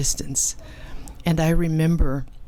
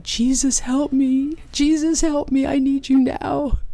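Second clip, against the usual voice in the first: much higher, crying out for help; desperate plea; 10 semitones higher than usual. Retold or imitated. Child crying out to Jesus in the dirt. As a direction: beg through tears. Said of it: "I could hear myself saying".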